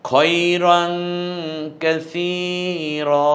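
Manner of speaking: slow, loud Quranic chant with a long held ending; Quranic recitation. Shown as a demonstration. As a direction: recite it like tajweed, stretching কাসীরা. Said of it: "কাসীরান না বলে কাসীরা".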